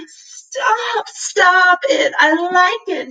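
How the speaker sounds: high, squealing with delight; giddy laughing. A narrator's reading. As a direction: laugh through it, tickled silly. Cat intoxicated, rolling in catnip.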